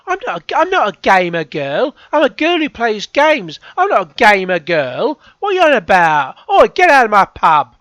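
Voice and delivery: put-on shouty high Cockney accent; loud, brash Cockney rant. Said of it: "best Cockney accent I can do".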